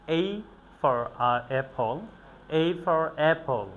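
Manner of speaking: "A for apple" spoken very slowly, word by word; slow drill. Shown as a demonstration. Teacher drilling "A for apple" slowly for students. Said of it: "teaching the letter slowly".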